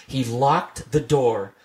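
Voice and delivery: plain reading voice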